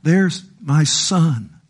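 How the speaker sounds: very slow, weighty presentation; solemn as God. Retold or imitated. God pointing sinners to the cross, slow solemn voice. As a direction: lay each word down slowly. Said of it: "God says".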